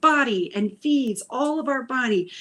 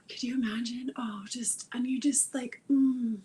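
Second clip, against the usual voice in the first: hushed gratitude; 2 semitones higher, 7 dB quieter than usual. Acted. Whispered thanks to the body.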